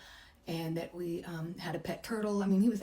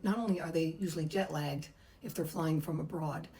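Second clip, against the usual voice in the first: normal talking voice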